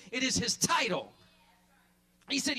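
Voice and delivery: ordinary delivery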